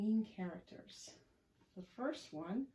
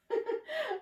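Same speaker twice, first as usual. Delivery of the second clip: high, loud giggle; gleeful laugh; 8 dB louder than usual. Acted. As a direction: burst out in delighted giggles. Friends laugh after the tumble.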